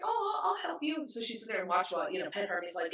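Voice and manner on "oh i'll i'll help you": put-on high-pitched toddler voice; imitating little niece. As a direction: pipe up like a small child offering help. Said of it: "She's like".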